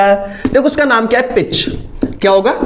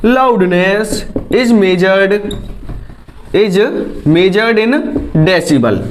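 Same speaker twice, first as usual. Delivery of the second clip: slow dictation; 3 semitones lower, much slower than usual. Shown as a demonstration. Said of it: "dictating definition slowly".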